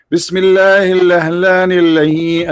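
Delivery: a hammed-up imitation of a cleric intoning; mock sheikh recitation. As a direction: chant it slowly like a wedding sheikh. Quotes the sheikh at the wedding.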